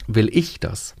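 "ich" stressed, questioning rise at the end. Shown as a demonstration. Coach showing how one stressed word changes the question, stress on "ich". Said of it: "wenn ich das nochmal so betone".

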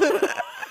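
extrem hohes Lachen; in ganz hohes Gelächter ausbrechen; echter Lachanfall. Real, not acted. Lacht über die Katzen-Anekdote. (hoher, echter Lachanfall)

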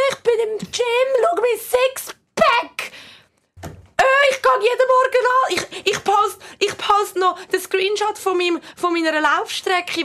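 high, slow, whiny bragging; mock a gym poster bragging; show-off voice. Mocking gym show-offs on social media.

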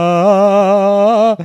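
a loud, high "uha"; loud mock exclamation. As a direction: burst in with a goofy whoop. Loud opener before greeting.

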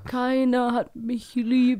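slow, moping elephant lament; slow mock self-pitying sigh of a lonely elephant; depressed Benjamin. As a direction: whine it like a sulking lonely elephant. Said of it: "wirklich ein bisschen Depri".

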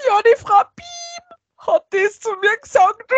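high mock blubbering; crying officer.

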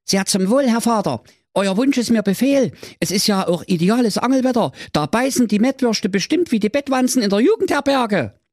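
cartoonish young prince answering his father; dopey prince. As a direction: play the obedient prince, a bit dim. Prince voiced in story.

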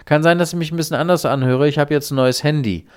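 high, loud, fast fake grandson; scam caller. Scammer posing as Jakob to grandma, high, loud and quick. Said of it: "Und dann sagt derjenige".

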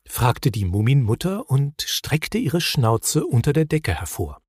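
ordinary delivery

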